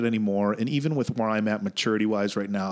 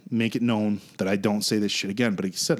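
ordinary delivery

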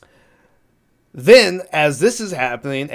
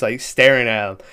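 normal talking voice

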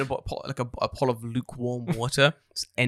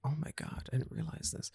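hushed realisation; 12 dB quieter than usual. Retold or imitated. Retelling own reaction to friend.

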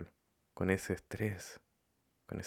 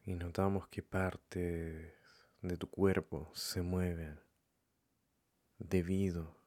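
calm, softened meditation voice with long pauses; guided meditation; 4 dB quieter than usual. Acted. Leading listeners to follow their breathing, calm meditation voice. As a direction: guide them gently, leaving room between phrases.

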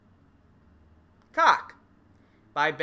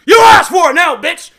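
much higher, much louder battle cry; furious shout; 11 semitones higher, 21 dB louder than usual. Acted. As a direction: scream it as you attack. Crazy girlfriend attacks in skit.

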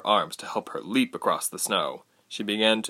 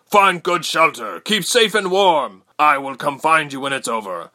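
Jonan roaring; 7 semitones higher, 7 dB louder than usual. A narrator's reading. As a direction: roar instructions over a battle. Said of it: "he roared in a voice".

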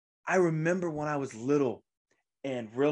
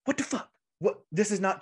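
a sharp high burst of shock, half out of the couch; reliving the jolt of hearing he died; stunned disbelief; 6 semitones higher than usual. Retold or imitated. Retelling the moment the news of Bob Saget's death hit, shocked outburst. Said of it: "I remember popping up and going".

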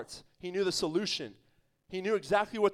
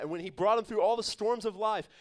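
ordinary delivery

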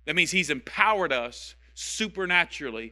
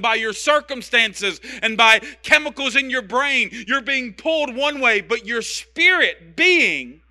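high, loud, forceful sermon delivery; impassioned preaching; 5 semitones higher, 7 dB louder than usual. Real, not acted. Preacher urging congregation to let actions speak, impassioned. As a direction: drive it home with punched key words.

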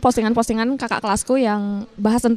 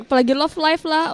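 ordinary delivery

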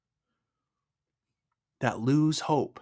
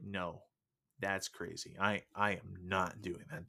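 much softer, lower muttered refusal; quiet refusal; 4 semitones lower, 12 dB quieter than usual. Retold or imitated. Man refusing the handstand prompt, quiet refusal. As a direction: dismiss the idea under the breath.

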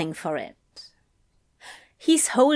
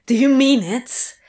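barking; 4 dB louder than usual. A narrator's reading. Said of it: "Crowley barks at him".

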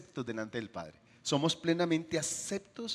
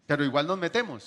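ordinary talk

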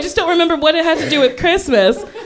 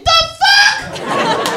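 shocked outburst. Retold or imitated. Meek sister sees wrecked van.

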